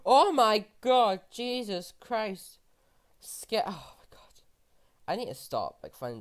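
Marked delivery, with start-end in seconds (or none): 0.1-2.6 gasping, slow and loud in disbelief; stunned by the find, drawn out; real shocked gasp. Real, not acted. Finding diamonds while mining. 3.2-4.4 awed whisper. Real, not acted. Whispered shock at diamonds.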